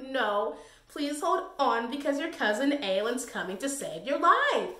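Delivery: ordinary delivery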